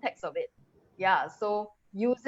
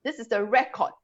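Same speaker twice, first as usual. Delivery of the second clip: "record" with a raised first syllable; first-syllable stress; 3 semitones higher than usual. Shown as a demonstration. Teacher repeating the noun, "record" stressed early. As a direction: hit the first half of "record". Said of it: "you will stress on the first syllable".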